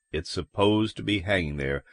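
low, soft, even statement; calm confirming cop. Acted. Cop confirming to the man about the body, calm. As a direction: repeat it back low and quiet, as fact.

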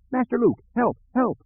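very high, shrill plea for help; terrified robot calling out; panicked droid cry. Acted.

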